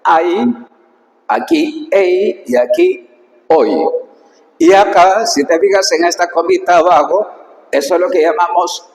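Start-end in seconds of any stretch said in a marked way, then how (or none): 0.0-3.8 "ai", "ei", "oi" drawn out slowly one by one; teacher reading Greek diphthongs aloud for students. Shown as a demonstration. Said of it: "vamos a leer".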